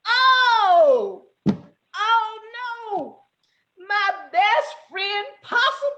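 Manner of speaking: high, drawn-out wailing lament; hammed-up distraught cry; mock grief. A narrator's reading. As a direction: wail over a fallen friend. Rabbit finds friend apparently dead.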